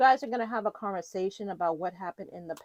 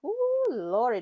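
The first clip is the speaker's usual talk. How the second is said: surprised; 7 semitones higher, 7 dB louder, slower than usual. Real, not acted. Surprise at the cards.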